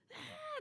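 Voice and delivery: short high mock scream; a sudden high yelp like a child screaming; imitated scream. Mother imitating her screaming daughter, high yelp. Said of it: "kayak teriaknya tuh".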